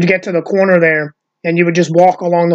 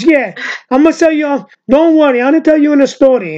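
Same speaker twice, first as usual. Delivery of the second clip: put-on high, loud Italian American accent; Uncle Louie; 8 semitones higher, 3 dB louder than usual. Imitating the comedian starting a story, Italian American accent. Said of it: "And he goes".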